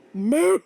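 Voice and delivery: sudden high, loud cow moo. Pretend. Breaking off the story with a silly loud moo. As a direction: blurt out a big moo.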